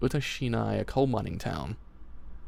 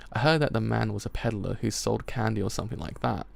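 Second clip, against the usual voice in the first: ordinary delivery, nothing marked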